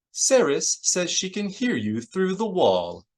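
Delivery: high, fussy droid translator; C three P O. A narrator's reading. Droid translating the guard for Han, high fussy voice. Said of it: "C three P O said".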